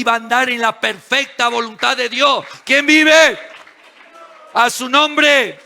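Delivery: high, rousing sermon delivery; full-force preaching. Real, not acted. Preacher telling church of God's perfect will, rousing and forceful. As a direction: build to a ringing call to the congregation.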